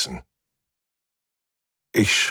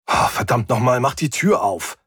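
annoyed, raised-pitch outburst; exasperated demand; 4 semitones higher than usual. A narrator's reading. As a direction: curse at a machine that won't obey. Swearing at a ship that ignores him. (genervt fluchend, höhere Stimme)

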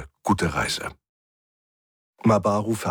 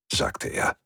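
usual speaking voice

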